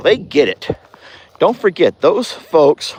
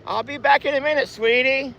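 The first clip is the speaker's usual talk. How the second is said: high voice for an animal; pet talk to Rita; 12 semitones higher than usual. Real, not acted. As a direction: promise the animal in a high, coaxing voice. Promising Rita he'll return.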